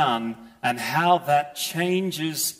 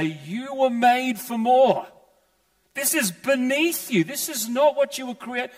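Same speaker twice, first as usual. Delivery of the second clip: compassionate plea; 7 semitones higher than usual. Retold or imitated. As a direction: lift them up gently, like a father. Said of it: "he'd say".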